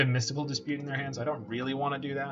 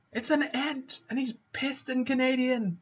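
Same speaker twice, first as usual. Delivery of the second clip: much higher cartoon ant voice; squeaky angry ant; 11 semitones higher than usual. Describing the Tree Folk card in a squeaky ant voice. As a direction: play a tiny furious ant.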